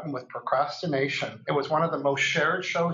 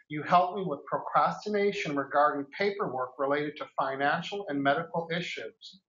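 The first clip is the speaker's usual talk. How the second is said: usual speaking voice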